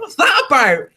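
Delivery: a hint of a baffled father; dad. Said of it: "He was like".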